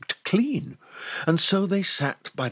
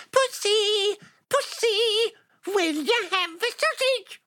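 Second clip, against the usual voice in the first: high squeaky elf voice. Acted. Elf repeats call to cat.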